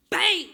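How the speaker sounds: loud imitated crash; crash sound effect. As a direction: blurt the crash like an explosion. Car crashes in lot.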